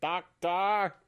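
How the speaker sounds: high, strained Dalek call. Retold or imitated. Mimicking Daleks off in the distance, high Dalek cry. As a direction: imitate a Dalek calling out from far off. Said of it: "you can hear Dalek voices".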